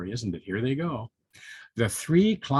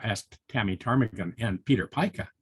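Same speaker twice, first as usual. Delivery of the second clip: ordinary delivery, nothing marked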